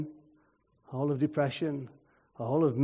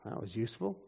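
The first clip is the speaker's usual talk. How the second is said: softer, understated wry aside; dry sarcasm; 5 semitones lower, 10 dB quieter, faster than usual. Real, not acted. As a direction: drop it down into dry irony. Wry verdict on useless priest.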